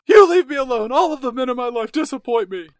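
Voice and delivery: overwrought wail. Acted. [name] wailing at [name] about the men in her life, in overwrought melodrama. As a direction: go full soap opera. Kat escalates after gasp.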